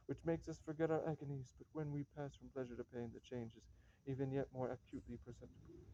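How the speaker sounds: usual speaking voice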